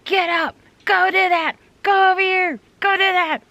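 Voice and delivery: high, loud barked coach orders; football coach yelling. Retold or imitated. Inner football coach shouting orders at her, full yell. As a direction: bark orders like a sideline coach. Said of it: "that football coach yells at me all the time".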